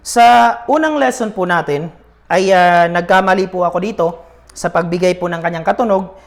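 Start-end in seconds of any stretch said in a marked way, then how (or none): none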